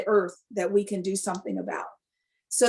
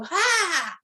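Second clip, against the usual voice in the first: excited gasp; 8 semitones higher than usual. Retold or imitated. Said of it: "he'd go".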